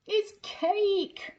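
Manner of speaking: high, loud gleeful announcement; spring the surprise on the kids; excited reveal. A narrator's reading. Narrator gasps at the cake.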